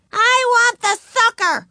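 put-on very high, loud child's scream; screaming tantrum. A narrator's reading. Ramona demands the lollipop in class, screaming. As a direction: shriek the demand like a little girl. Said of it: "screamed Ramona".

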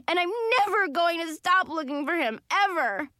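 a light catch of tears, determined; voice breaking. A narrator's reading. Girl vows to uncle to find her cat, voice breaking. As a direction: insist through tears, lightly done. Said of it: "my hoarse voice breaking".

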